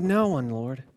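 very low, soft answer; hushed reply. Acted. Accused woman answering Jesus, quiet and subdued. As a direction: drop to a subdued near-murmur.

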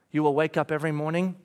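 ordinary delivery

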